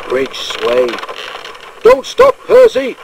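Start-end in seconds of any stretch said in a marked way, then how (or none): 1.8-3.0 urgent, high, loud cry; alarmed shout. A narrator's reading. Driver warns Percy on the swaying bridge, alarmed shout. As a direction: shout out in sudden alarm, voice leaping up. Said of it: "shouted his driver in alarm".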